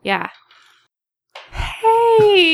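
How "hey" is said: sharp high call-out; surprised shout. Real, not acted. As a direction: catch them in the act. Catches someone peeking at answer.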